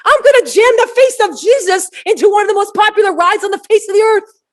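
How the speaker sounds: high, loud, shouted boast; imagined ride designer shouting his plan; defiant builder. Retold or imitated. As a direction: proclaim it like a bold prankster.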